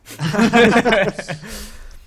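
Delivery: big burst of high laughter; loud laugh. Real, not acted. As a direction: crack up loudly at the joke. Laughing at a group joke.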